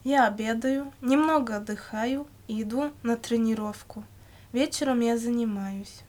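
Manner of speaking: usual speaking voice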